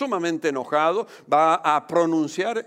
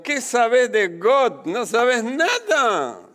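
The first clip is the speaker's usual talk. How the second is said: high, slow, drawn-out scolding; hammed-up challenge, stretching the words; mock indignant retort; 7 semitones higher, 4 dB louder, slower than usual. Preacher retelling his reply to the neighbour's child, mock indignant. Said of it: "Yo en mi perfecto francés le digo".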